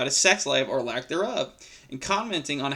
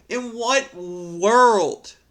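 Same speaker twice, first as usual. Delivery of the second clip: "In what world?" stretched very slow, higher and louder, weight on "what"; drawn-out disbelief; 5 semitones higher, 5 dB louder, much slower than usual. Real, not acted. Vlogger asking the poster, in slow drawn-out disbelief. Incredulous rant at Reddit poster.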